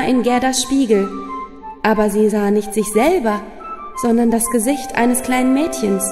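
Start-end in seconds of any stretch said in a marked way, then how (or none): none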